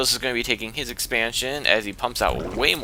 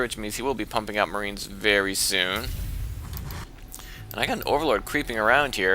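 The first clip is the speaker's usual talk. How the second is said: usual speaking voice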